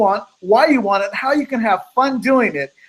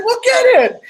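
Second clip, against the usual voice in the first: emphatic urging; 13 semitones higher, 5 dB louder than usual. Retold or imitated. Speaker urging her on.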